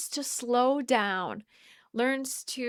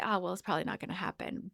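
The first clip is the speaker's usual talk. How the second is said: resigned mumble; 3 semitones lower, 8 dB quieter, much faster than usual. Retold or imitated. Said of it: "and I could say".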